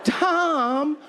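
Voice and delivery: high, slow, whiny mock protest; hammed-up shocked listener; offended audience member. Mock heckler voicing the audience.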